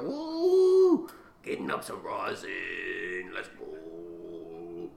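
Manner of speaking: excited cartoon chant; hyped-up rosin press voice. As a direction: hype up the machine, chanting. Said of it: "He's just like".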